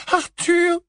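very high, tearful cry; sobbing cry. A narrator's reading. Mrs Weasley sees her husband arrive, sobbing cry. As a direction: cry out the name through sobs. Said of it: "sanglota Missus Weasley".

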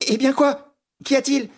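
high, alarmed questions; startled questioning. Acted. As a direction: ask sharply what is wrong, pitch jumping up. Startled reply to a frightened grip.

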